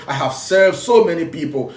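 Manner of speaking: ordinary delivery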